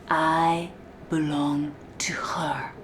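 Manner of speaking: breathy whispered vow of submission; whispered mantra. Acted. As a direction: murmur it like a secret creed. Mantra he must repeat.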